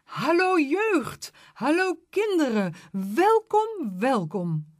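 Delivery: put-on high, slow, loud elderly greeting; elderly residents calling a welcome; calling old folks. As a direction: call out a welcome like cheerful old people. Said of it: "ze riepen".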